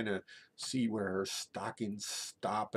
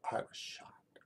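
near-whisper of shock; stunned hush; 9 dB quieter than usual. Retold or imitated. Student just told of the teacher's death, stunned near-whisper. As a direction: say it very softly, as if stunned.

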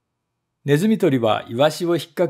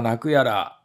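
normal talking voice

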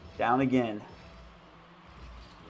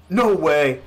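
shouted disbelief; 4 semitones higher, 12 dB louder than usual. Real, not acted. Conceding the winning goal.

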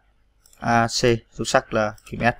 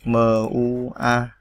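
letters drawn out one by one; slow letter spelling; much slower than usual. Shown as a demonstration. Teacher spelling letters slowly for typing learners. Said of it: "Rồi mua".

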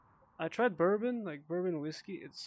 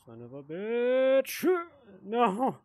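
frustrated yell; 7 semitones higher, 7 dB louder, much slower than usual. Real, not acted. Game failure outburst.

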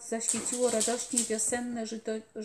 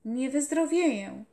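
louder, raised defiant cry; loud stubborn call; calling out again; 3 semitones higher, 7 dB louder than usual. A narrator's reading. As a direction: insist loudly. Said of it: "zawołał ponownie".

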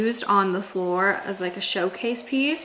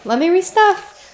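pet talk; 9 semitones higher, 10 dB louder than usual. Real, not acted. Talking to her dogs.

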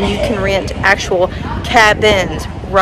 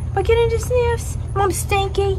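high pet talk; 9 semitones higher, 4 dB quieter than usual. Real, not acted. Owner talking to sniffing dog, high baby voice.

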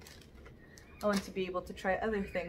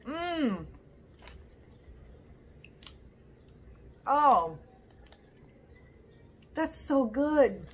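chewing candy while talking; speaking through a mouthful; mouth full, chewing; 5 semitones higher, 3 dB louder, much slower than usual. Real, not acted. Seen 0: Candy put in mouth at -2; closed mouth, tasting grimaces throughout.

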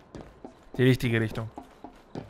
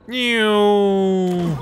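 whooshing sound effect; 7 semitones higher, 8 dB louder than usual. Retold or imitated. Mimics flying-off noise.

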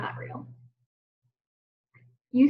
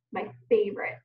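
ordinary talk

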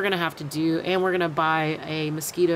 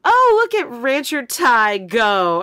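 a slow, louder teasing call; mock yell; 5 semitones higher, 9 dB louder, slower than usual. Brother yells from the car at his sister lifting feed bags, a slow, louder teasing call. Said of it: "My brother yells out".

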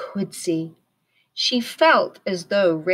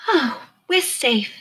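slow, higher relieved voice; relieved sigh; 7 semitones higher than usual. A narrator's reading. Stellaluna relieved after the rescue, slow sighing voice. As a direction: breathe it out in relief. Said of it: "and then she sighed".

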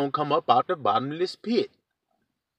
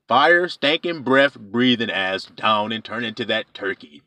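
ordinary talk

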